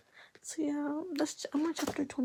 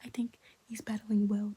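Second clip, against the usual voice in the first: ordinary delivery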